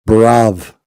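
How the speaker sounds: loud, punched "bruv", one syllable ending in v; "bruv" slang pronunciation. Shown as a demonstration. Correcting a listener's slang, loud one-syllable "bruv". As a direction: belt out "bruv" with a final v. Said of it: "It's bruv".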